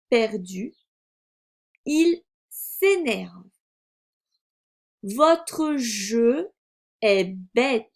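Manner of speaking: very slow, word-by-word reading; slow reading aloud. A narrator's reading. Teacher models reading for a beginning reader, slow word-by-word. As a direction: read deliberately with gaps between words.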